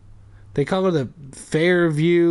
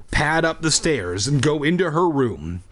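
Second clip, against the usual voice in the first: ordinary delivery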